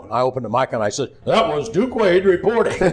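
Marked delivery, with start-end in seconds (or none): 1.3-2.8 higher, louder hammed-up announcer sign-off; mock announcer voice. Announcer teasing his colleague on air, mock big announcer voice. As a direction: ham up the colleague's big radio voice.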